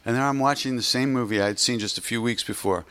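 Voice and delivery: ordinary talk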